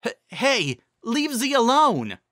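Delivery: put-on a small brave kid shouting; small dragon voice. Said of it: "the sound of the small voice".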